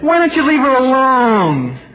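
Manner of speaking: mock woman's voice. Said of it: "she said".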